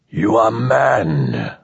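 slow, solemn machine-like intoning; intoning alien. Acted. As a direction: intone it slowly like a pronouncement. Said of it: "the beast intoned".

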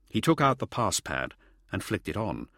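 plain reading voice

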